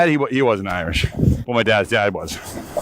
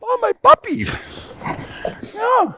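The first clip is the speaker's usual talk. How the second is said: high, slow cooing puppy talk; doggy voice; much slower than usual. Pretend. Hiker coos at his puppies in the snow, slow high doggy voice. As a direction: croon to the dogs, drawn out. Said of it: "That was my doggy voice".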